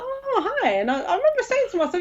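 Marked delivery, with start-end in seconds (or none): 0.0-0.7 sweet greeting. Retold or imitated. Acting her first phone greeting.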